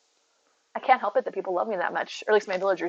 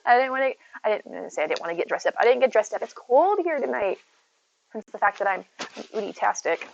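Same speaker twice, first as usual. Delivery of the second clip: normal talking voice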